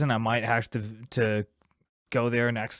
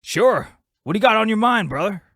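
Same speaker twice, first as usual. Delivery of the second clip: skit voice; 8 semitones higher, 5 dB louder than usual. Acted. Skit character replies.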